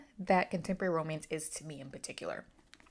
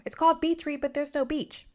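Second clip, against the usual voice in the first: higher and louder, mimicking whiny complainers; mock complaint; 10 semitones higher, 5 dB louder than usual. Reviewer mocks complaining readers, hammed-up indignation. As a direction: parody the critics with exaggerated indignation.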